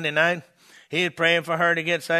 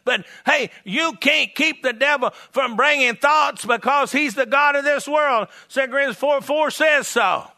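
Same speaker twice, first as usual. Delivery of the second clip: high-pitched, driving revival preacher; full-force preaching; 11 semitones higher, 6 dB louder than usual. Real, not acted. Evangelist defying the devil before his church, fervent preaching. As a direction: fire up the congregation, voice raised and pressing.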